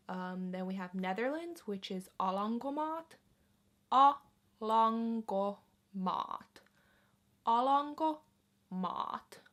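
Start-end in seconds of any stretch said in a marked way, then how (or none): 3.9-9.4 slow demo. Shown as a demonstration. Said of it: "repeating word for learners".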